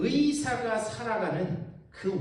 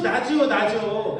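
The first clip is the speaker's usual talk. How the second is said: slow insistence; 3 semitones higher, 6 dB louder, much slower than usual. Retold or imitated. Patient's selfish view, slowed.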